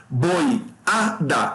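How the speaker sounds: Boi, a, da as three separated beats; syllable by syllable. Shown as a demonstration. Teacher splits boiada for students, syllable by syllable. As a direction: pause between Boi, a and da. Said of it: "como é que separa".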